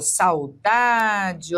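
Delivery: "saudade" with a and u joined as sau, stress on DA; diphthong in saudade. Shown as a demonstration. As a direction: glide sau together and lengthen DA in "saudade". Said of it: "esse A e esse U são pronunciados".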